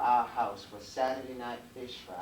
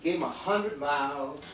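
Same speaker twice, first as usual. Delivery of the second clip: lively, higher folk-tale teller voice with big swings; Uncle Roy spinning a tale; 5 semitones higher, 5 dB louder than usual. Acted. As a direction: spin it like a front-porch storyteller to a child.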